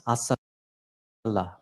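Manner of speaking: "Asala" drawn out slowly as a model pronunciation. Shown as a demonstration. Teacher showing listeners how we pronounce it, "Asala" said slowly. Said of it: "We pronounce it as".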